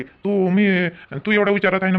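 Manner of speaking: usual speaking voice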